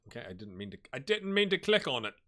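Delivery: ordinary talk, nothing marked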